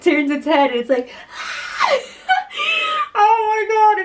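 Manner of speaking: high-pitched talk dissolving into laughter; real laughing. Real, not acted. Vlogger recalling the dinosaur edit, helpless laughter. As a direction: lose it laughing while describing it. Cracking up over funny edits.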